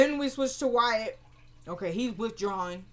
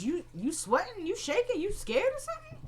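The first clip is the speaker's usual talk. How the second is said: high questions climbing ever higher; mock taunting; 11 semitones higher than usual. Man taunting his shaking captive, mocking and high-pitched.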